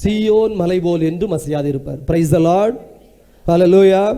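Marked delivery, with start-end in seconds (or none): 2.1-4.1 called out to congregation. Real, not acted. Call to the congregation.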